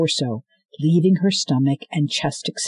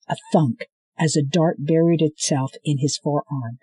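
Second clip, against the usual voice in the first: plain reading voice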